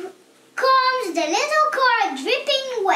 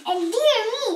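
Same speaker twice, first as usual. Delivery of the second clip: ordinary delivery, nothing marked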